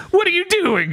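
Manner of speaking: very high-pitched mock panic; panicked bat. Bat to Denji as the girl runs off, high mock panic. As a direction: squeal it as a flustered bat. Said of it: "and the bat's just like".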